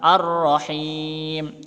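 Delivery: Ar-Rahim with the rr pressed hard and held; slow Ar-Rahim, press the tasydid. Shown as a demonstration. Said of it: "Maka harus ditekan".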